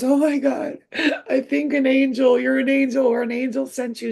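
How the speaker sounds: high-pitched mock-weepy amazement; imitated tearful awe. Bawling client calls the psychic an angel, imitated tearful awe. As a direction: mimic an overwhelmed client crying out in gratitude. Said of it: "she starts crying. Like, when I say bawling".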